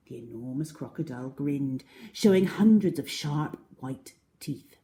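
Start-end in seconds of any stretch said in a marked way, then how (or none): none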